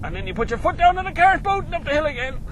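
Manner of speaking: high, loud, racing delivery; thrilled excitement. Real, not acted. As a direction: rush it out as the car surges. Accelerating hard up the hill.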